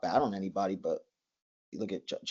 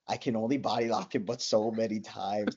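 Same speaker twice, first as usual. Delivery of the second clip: tired complaint to the coach; 3 semitones higher, 4 dB louder than usual. Retold or imitated. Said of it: "I go".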